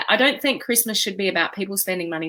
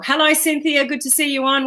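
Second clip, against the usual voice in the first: ordinary talk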